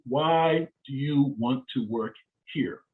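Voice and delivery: drawn-out words, evenly spaced; slow, deliberate question. Shown as a demonstration. Interview coach repeating the key question to job seekers, slow and deliberate. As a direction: lay the question out one word at a time.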